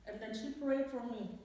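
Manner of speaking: ordinary talk, nothing marked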